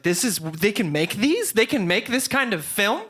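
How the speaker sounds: incredulous, rising wonder; retold amazement. Retold amazement at first film.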